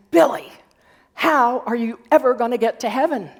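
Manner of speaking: put-on fed-up mom telling off her kid; exasperated mom. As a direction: call out the name loudly, then scold in mock exasperation. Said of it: "his mom was getting extremely exasperated".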